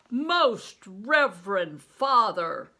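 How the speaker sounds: slow, loud and formal, for the crowd; loud public address. A narrator's reading. Juggler loudly hailing the priest before onlookers, booming. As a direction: call it out so the whole square hears. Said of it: "in his voice loud enough so all onlookers might hear".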